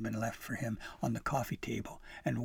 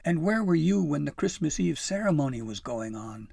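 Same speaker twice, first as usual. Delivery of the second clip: loud, high accusing question; heated scolding; raging; 4 semitones higher, 9 dB louder than usual. A narrator's reading. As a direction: demand an answer in anger. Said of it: "she raged".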